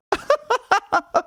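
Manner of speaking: a sudden high burst of disbelieving laughter; incredulous laugh. Real, not acted. Journalist laughing at a report about Canada's government, high incredulous laugh.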